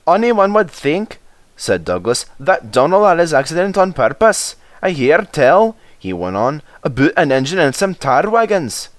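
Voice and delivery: high, sly Scottish engine; teasing Douglas. Acted. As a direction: needle James knowingly.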